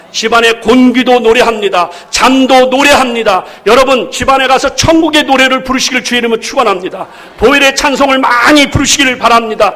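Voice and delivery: loud, rising sermon climax; loud fervent preaching voice; full-force preaching. Real, not acted. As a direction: preach it out to the congregation.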